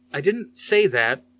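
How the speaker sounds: defensive. Acted. Daniel defends himself.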